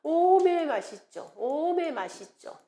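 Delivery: lilting Jeolla-dialect catchphrase. Shown as a demonstration. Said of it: "전라도 사투리로 쓰면".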